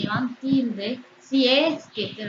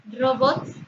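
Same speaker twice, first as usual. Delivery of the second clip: "Robots" with the weight on BOTS; stress on last syllable. Shown as a demonstration. Teacher sounding out an aguda word, stressed BOTS in "Robots". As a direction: say "Robots" on its own, rising onto the final syllable. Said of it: "tónica en la última sílaba".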